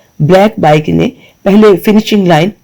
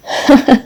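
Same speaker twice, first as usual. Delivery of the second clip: giggle; 7 semitones higher than usual. A narrator's reading. Mahi giggles at Olivia's Urdu.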